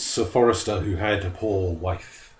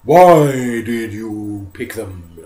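very slow, loud booming bear; demanding bear; 10 dB louder, much slower than usual. Acted. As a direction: draw it out menacingly.

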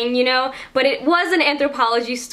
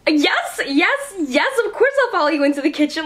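higher, faster, eager agreement; eager yes; 4 semitones higher than usual. Retold or imitated. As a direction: blurt it out thrilled to be chosen. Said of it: "And I go".

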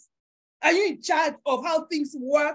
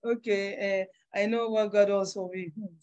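mock timid self; 6 semitones lower, 5 dB quieter than usual. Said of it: "I used to show up like that".

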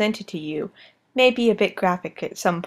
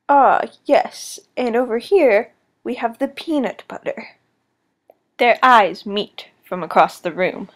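hushed wildlife documentary narrator; nature documentary. Acted. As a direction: narrate like a nature film host.

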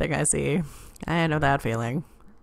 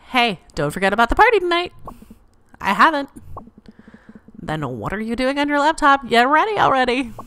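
louder, higher pushy nagging; nagging friend; 5 semitones higher, 5 dB louder, faster than usual. Acted. Friend Bea hurries May along, loud nagging. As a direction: hurry her up, loud and impatient.

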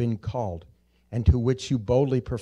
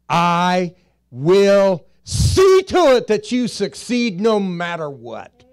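the Lord speaking; 6 semitones higher, 8 dB louder, slower than usual. Retold or imitated. Preacher voicing the Lord.

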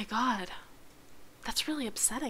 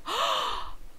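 sharp gasp; 13 semitones higher, 9 dB quieter than usual. Real, not acted. Game crashes mid-stream.

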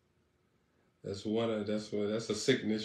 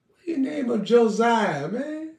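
high, loud incredulous question; loud mock-outraged question; mock outrage; 10 semitones higher, 6 dB louder than usual. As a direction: demand it in hammed-up disbelief.

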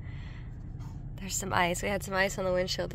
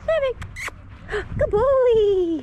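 high, loud coaxing call to a dog; squeaky praising a dog; 12 semitones higher, 8 dB louder than usual. Real, not acted. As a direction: sing out to the dog.

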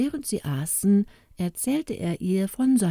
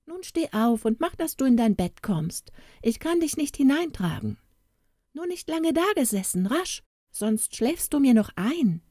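high-pitched, urging scold; storybook wife giving orders, pitched up; bossy wife calling out; 7 semitones higher than usual. A narrator's reading. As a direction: hustle a drowsy husband off to bed. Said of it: "packte sie ihn am Kragen und rief".

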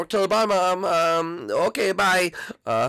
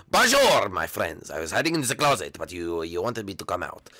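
lower, hammed-up French accent; a mock Frenchman, lazy and proud; 10 semitones lower than usual. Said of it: "I am French Koopa".

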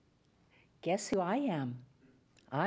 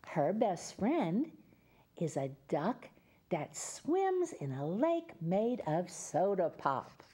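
put-on reading to children; 2 semitones lower than usual. Reads picture book to children.

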